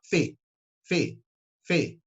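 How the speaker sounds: "Fê" with a closed ê. Shown as a demonstration. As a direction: say "Fê" like a nickname. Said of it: "não é esse som?". ("Fê" com ê fechado)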